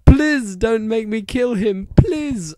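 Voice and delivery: high pleading voice; begging. A narrator's reading. Ebony begging the villain to spare Draco. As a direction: plead for his life. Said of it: "I begged".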